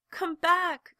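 high, loud call. A narrator's reading. Little rabbit calls after the vanished rabbits, high and loud. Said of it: "called the little rabbit".

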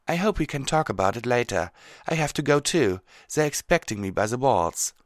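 lightly voiced earnest mare; concerned Twilight. Twilight's character voice.

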